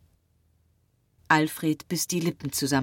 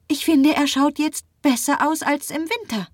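high, louder, hopeful woman's voice; Marie insisting; 12 semitones higher, 6 dB louder than usual. A narrator's reading. Marie insists the patient looks better, high voice. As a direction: insist brightly in a much higher register.

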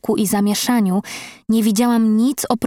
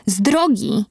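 understated barked warning; mild narrated roar. A narrator's reading. Jones orders the little ones aside, a mild roar. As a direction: clear the way with a restrained roar. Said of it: "ryknął na krewetki".

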